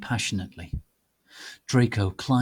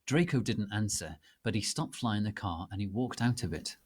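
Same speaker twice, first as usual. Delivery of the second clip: plain reading voice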